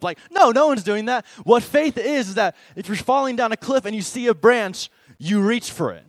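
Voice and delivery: emphatic preaching. Real, not acted. Preacher to students, rejecting the idea, loud and emphatic. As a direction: punch it out to the crowd. Emphatic rebuttal, much louder.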